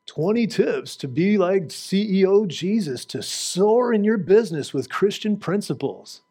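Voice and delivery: slow, hammed-up self-help title voice; mocking prosperity-gospel pitch; mock sales pitch. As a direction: sell it like a cheesy book cover.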